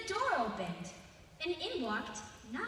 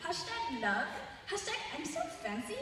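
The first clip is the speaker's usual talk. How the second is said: put-on higher, louder valley-girl gush with laughs; gushing teen voice; 5 semitones higher, 4 dB louder, slower than usual. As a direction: squeal like an excited teenager. Old man posts selfie hashtags.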